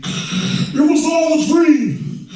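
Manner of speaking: mock [name] flow; [name]. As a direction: rap it like the [name] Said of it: "impersonations of famous big people".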